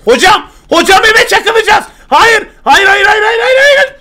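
high, loud panicked "hayır" shrieks; panicked screaming. Real, not acted. Streamer sees his helicopter about to hit the house, screaming in panic. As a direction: scream in panic before the crash.

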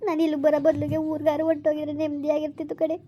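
ordinary talk

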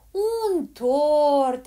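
loud, high, drawn-out amazement; old woman's booming surprise; astonished exclamation. A narrator's reading. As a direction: exclaim it in big wonder. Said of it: "exclamă bătrâna".

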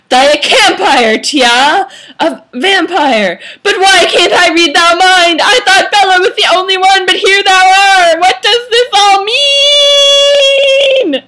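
loud, high melodramatic rant building to a wail; hammed-up anguished yelling; furious shout. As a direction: stretch the last word into a long howl. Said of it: "shouted suddenly furious".

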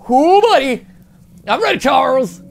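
high, loud battle cry; pumped-up call. Real, not acted. Player tells Charles he's ready, excited shout. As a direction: call out the challenge, fired up.

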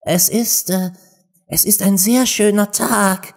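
high, hesitant small voice; shy. A narrator's reading. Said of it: "sagte schüchtern eine Stimme neben ihr". (schüchtern)

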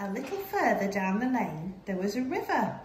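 ordinary delivery

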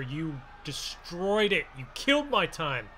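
ordinary delivery

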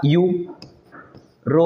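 European broken slowly into यू, रो, peon; syllable by syllable. Shown as a demonstration. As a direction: sound out European with long gaps between parts. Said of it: "पहला क्या होगा". (European को टुकड़ों में, रुक-रुककर बोलते हुए)

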